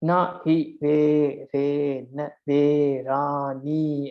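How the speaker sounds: very slow "Na hi ve re na ve ra ni", each syllable held apart; slow, separated syllables in "Na hi ve re na ve ra ni"; syllable-by-syllable counting. Shown as a demonstration. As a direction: count out "Na hi ve re na ve ra ni" one drawn-out syllable at a time. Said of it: "You can count them".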